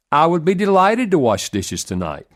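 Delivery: a sarcastic, resentful snarl of a chore offer. Shown as a demonstration. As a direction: spit the offer out grudgingly. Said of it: "said in a snarling tone".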